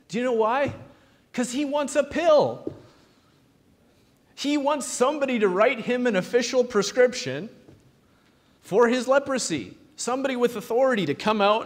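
high, loud, driving preacher build; fiery sermon crescendo; rising preaching shout. Real, not acted. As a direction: preach it up hard to a climax. Preacher building to full force.

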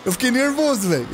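high-pitched nervous excitement; thrilled and jittery. Real, not acted. Streamer tells viewers the start scared him, high excited gush. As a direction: gush about the rush, voice up high. Rush after the race start.

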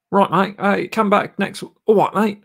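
high-pitched put-on Cockney; amateur Cockney accent, high voice. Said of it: "What's that, Cockney?".

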